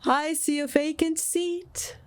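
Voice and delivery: high, slow spectral voice; solemn ghost. A narrator's reading. Ghost foretelling to Scrooge, slow solemn voice. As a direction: pronounce it like a prophecy. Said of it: "replied the ghost".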